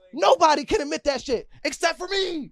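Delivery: worked-up, high-pitched insistence; heated, emphatic argument pitched high; yelling. Real, not acted. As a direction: raise the voice in real exasperation. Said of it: "that's why I'm yelling like this".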